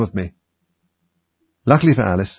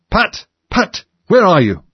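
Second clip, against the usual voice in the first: high, angry shout for a servant; cross, loud summons; 11 semitones higher, 7 dB louder, slower than usual. A narrator's reading. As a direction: call out sharply, pitched way up. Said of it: "Next came an angry voice".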